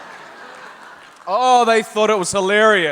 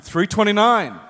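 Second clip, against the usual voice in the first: raised, higher call-out; 4 semitones higher than usual. Acted. Passing the friend's room number to the waiter, called out brightly. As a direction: announce the room number for all to hear.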